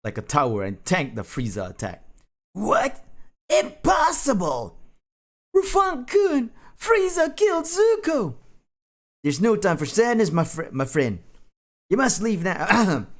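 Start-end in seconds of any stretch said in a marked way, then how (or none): 2.5-8.4 very high, loud squeal of alarm; hammed-up high voice of dismay; shocked anime character. Shocked character in anime fan fiction. 9.2-13.1 struggling attempt at a hero's voice; Rufang. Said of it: "I just can't do Rufung's voice".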